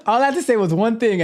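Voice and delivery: amused laughing talk. Real, not acted. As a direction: still cracking up. Still laughing at chat.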